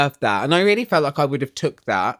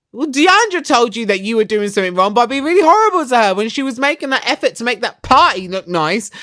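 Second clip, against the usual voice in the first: higher, louder indignant telling-off; indignant rant; 6 semitones higher, 4 dB louder, faster than usual. Real, not acted. Vlogger scolding Carrie for being horrible to Deandra, heated. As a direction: scold her, fast and heated.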